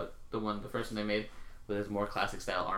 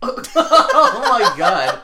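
laughing; 12 semitones higher, 16 dB louder, much slower than usual. Real, not acted. Reacting to crude joke.

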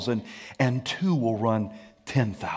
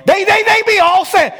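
mock yelling spouse; 7 dB louder than usual. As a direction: shout they all say like a nagging partner. Mock spouse citing others.

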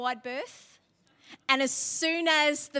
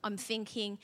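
usual speaking voice

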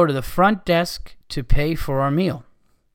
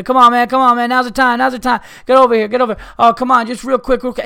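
loud, high, pushy carnie hustle; mock carnival hustler, louder and higher; fast-talking carnie; 7 semitones higher, 6 dB louder than usual. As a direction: hammer the pitch like a fast-talking barker. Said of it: "dicks and fast talkers".

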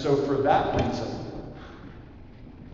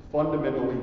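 usual speaking voice

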